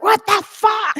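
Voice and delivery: high, mock-aghast outburst; hammed-up sibling protest; incredulous outrage. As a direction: exclaim in comic disbelief, punching each word. Acts out siblings' shared outrage.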